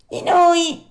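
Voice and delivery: high, loud whining echo; echoing cat. A narrator's reading. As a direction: tack on the cat's pleading echo. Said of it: "ripeté il gatto".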